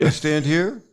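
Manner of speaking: hammed-up, poor imitation of a relaxed entertainer. Host mimics the star's casual rehearsals, bad impression.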